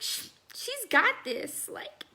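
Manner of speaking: slow, proud words breaking into a laugh; a light laugh in a slow, proud mama voice. Retold or imitated. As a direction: laugh through it, proud of your girl. Proud mama's inner voice, laughing.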